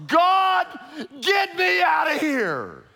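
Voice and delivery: high, loud desperate cry to God; anguished scream. Retold or imitated. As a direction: scream to be let out. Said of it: "You'll be screaming".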